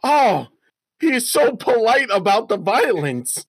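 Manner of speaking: baffled by a polite villain; squeaky disbelief, racing at the end; incredulous. Real, not acted. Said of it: "reacting to the polite villain".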